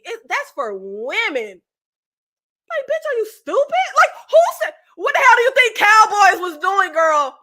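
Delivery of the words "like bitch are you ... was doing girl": much higher, louder disbelieving rant; exasperated outburst. Real, not acted. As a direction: blow up in disbelief at how dumb it is. Outraged rant about Hannah.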